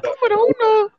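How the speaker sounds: very high squeaky man voice. Acted. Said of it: "Voz de hombre pitudo".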